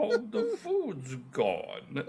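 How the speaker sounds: high, slow mock lament; sad cartoon fridge. Co-host joining the fridge bit, high slow mock wail. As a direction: drag out the woe, laughing through it.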